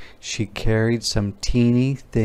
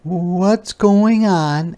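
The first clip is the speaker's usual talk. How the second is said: high, slow questioning teacher; a teacher stepping in; concerned teacher; 8 semitones higher, 5 dB louder, much slower than usual. Acted. Missus Olson asks the quarreling children, high and slow. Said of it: "asked Missus Olson".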